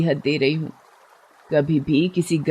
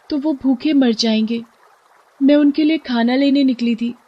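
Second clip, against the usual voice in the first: higher, pleading little fish; 6 semitones higher than usual. Acted. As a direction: beg to be let go for her babies, voiced high.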